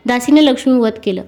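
usual speaking voice

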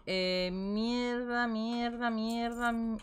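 soft, slow muttered curses; cursing under breath. Real, not acted. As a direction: mutter it to yourself. Muttering while clearing inventory.

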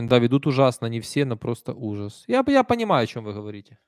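ordinary talk

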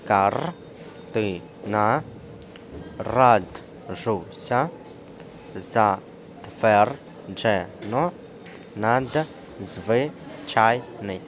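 very slow, pause after every syllable. Shown as a demonstration. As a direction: sound out each syllable separately. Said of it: "як ці слова на слух діляться на склади". (дуже повільно, по складах)

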